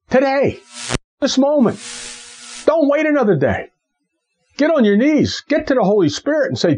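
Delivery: high, pressing preacher call; pleading with the youth to wake up; urgent exhortation. Acted. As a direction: rouse the listeners to act now.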